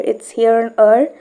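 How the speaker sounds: ordinary talk, nothing marked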